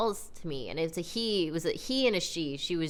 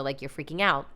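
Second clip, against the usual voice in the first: ordinary talk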